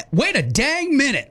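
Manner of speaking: put-on huffy old-timer stopping things; mock indignant. Host reading a listener's text, slow mock indignation. Performing saying.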